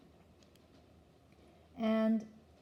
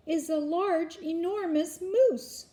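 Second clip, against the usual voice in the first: high, loud, drawn-out announcement; excited kid, pitched up; excited boy; 8 semitones higher, 5 dB louder than usual. A narrator's reading. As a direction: stretch out how huge it is. Boy reporting to startled father.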